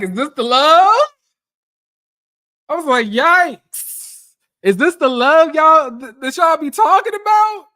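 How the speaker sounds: high, loud, disbelieving yell at the audience; outraged shout. Real, not acted. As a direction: cry out in mock horror at this love. Outraged reaction to husband's words.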